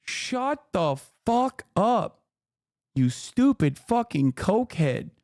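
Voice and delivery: sneering slow repeat. Real, not acted. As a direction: drag every word in disdain. Repeat insult.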